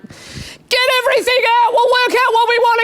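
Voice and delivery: high, strained mid-labour cry; mock labouring mother, high and strained; desperate labour voice. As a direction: push it all out in a high strained voice. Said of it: "You're just like".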